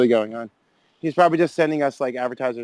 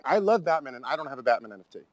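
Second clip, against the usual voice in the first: normal talking voice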